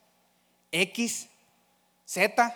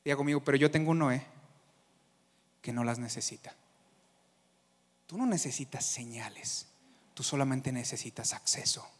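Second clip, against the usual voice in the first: quiet, lower, gentle; soft intimate preaching; 6 semitones lower, 6 dB quieter than usual. Acted. Preacher closing in quiet reassurance. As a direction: draw them close and speak softly.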